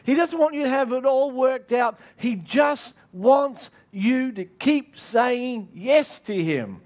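usual speaking voice

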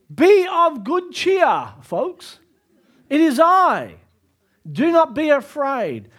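high, loud, slow proclamation; proclaiming. Acted. Jesus calling to the frightened disciples, loud and high. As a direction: boom it out as Jesus.

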